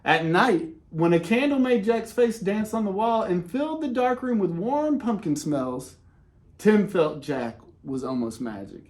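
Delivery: ordinary delivery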